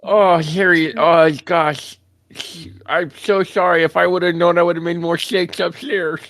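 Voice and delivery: high apologetic voice. Acted. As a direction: fret like a worried host. Apologizing to Harriet in character.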